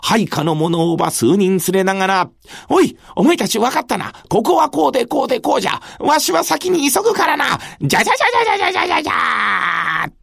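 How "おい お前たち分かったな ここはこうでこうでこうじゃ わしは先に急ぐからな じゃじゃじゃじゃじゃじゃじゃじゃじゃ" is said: fast, high, shouted orders; brisk commander. Acted. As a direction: rattle off orders and dash away. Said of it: "と猿と言われる人だけのことはあります".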